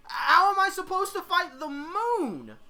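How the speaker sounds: high, loud incredulous outburst; shrieked disbelieving question; dumbfounded. Acted. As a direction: wail it slowly, exasperated and loud. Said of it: "Maka is dumbfounded by this".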